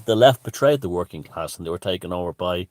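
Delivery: normal talking voice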